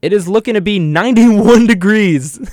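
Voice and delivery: hammed-up weather presenter; mock weather forecaster. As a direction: play up the temperature like a TV weather report.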